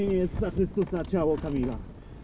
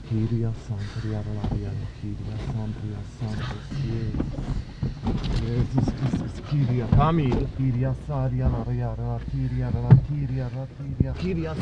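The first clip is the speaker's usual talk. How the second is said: speaking in tongues; 7 semitones lower than usual. Real, not acted. Prayer in tongues during exorcism.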